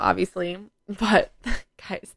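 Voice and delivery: normal talking voice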